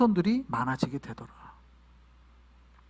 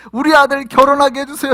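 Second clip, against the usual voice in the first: higher, louder mock plea; praying parent; 8 semitones higher, 10 dB louder than usual. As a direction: call out a parent's prayer request. Acts out a parent's prayer request.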